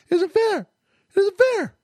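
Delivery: high-pitched mock whine of protest; mock crybaby complaint. Host mocking fans crying over lost overtime, mock whining. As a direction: imitate a sore loser's high whine. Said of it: "crying about".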